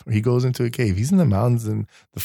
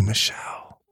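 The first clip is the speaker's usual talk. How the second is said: hushed whispered name; 8 dB quieter than usual. Retold or imitated. God gently calls Michelle in a whisper. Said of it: "he has that voice that comes out".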